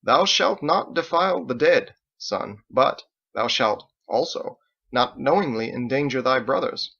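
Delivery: slow, solemn scripture recital; quoting doctrine. Acted. Father solemnly quoting doctrine to his son, slow. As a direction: intone it like a preacher. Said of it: "quoted doctrine".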